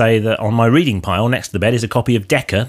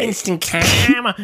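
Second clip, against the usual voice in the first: slow, higher Liverpudlian "karma"; Liverpudlian accent; 7 semitones higher, slower than usual. Shown as a demonstration. Co-host trying out the Liverpudlian tip, slow. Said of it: "I don't see how instant karma works".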